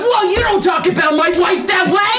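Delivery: loud high-pitched outrage defending his wife; indignant shout. Acted. Snake husband shouts at the captive, offended.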